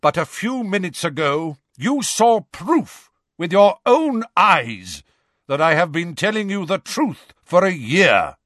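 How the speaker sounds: thunderous voice. Acted. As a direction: thunder the challenge. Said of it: "in a thunderous voice".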